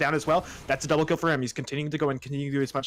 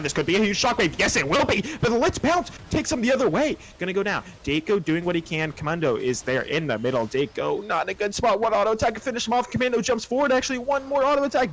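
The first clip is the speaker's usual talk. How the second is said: rising, louder caster hype; hyped play-by-play; 7 semitones higher, 3 dB louder than usual. Real, not acted. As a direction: build the call to a peak.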